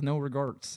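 "regrets" mangled as "re-garts"; misspelt tattoo word. Retold or imitated. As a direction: say "regrets" wrongly, with "gart" for "gret". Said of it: "Look, it says".

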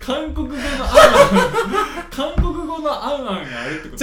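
laughing. Real, not acted. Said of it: "違う違う違う違う違う。".

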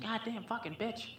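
high, slow, strained imitation of a raging dad; lightly voiced maniac father; dad cursing at mom, imitated; mock dad yell. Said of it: "always screaming".

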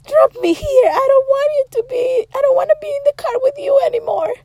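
high, rushed mock-crying wail; imitated tearful sister. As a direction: act out a crying, frantic plea. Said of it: "she was crying".

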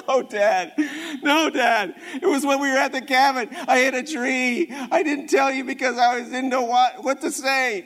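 loud, high, tumbling confession; gushing confession. Retold or imitated. Son regurgitates the whole truth to dad, loud gushing confession. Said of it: "regurgitate all over my dad".

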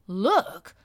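loud, outraged single word; indignant exclamation. Acted. Housekeeper scoffing at the nurse's claim, indignant loud exclamation. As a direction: spit it back in disbelief. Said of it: "exclaimed Missus Medlock indignantly".

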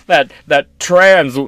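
usual speaking voice

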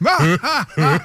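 Cruella-style villain cackle; evil chuckle. Pretend. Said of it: "Espèce de gros rire machiavélique de Cruella".

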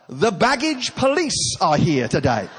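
drawn-out mock proclamation; loud sarcastic declaration. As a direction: announce it pointedly for all to hear. Mock announcement aimed at the couple.

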